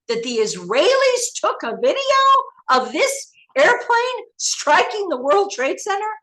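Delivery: high, loud indignant outburst; furious. Retold or imitated. Confronting her handler about the Israeli video, high furious disbelief. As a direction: demand an answer in disbelief and rage. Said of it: "I was furious".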